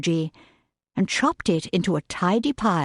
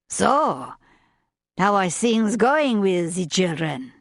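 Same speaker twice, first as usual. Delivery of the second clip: put-on French accent; slower than usual. A narrator's reading. French chef asks the governess about the children, French accent. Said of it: "with that strong French accent".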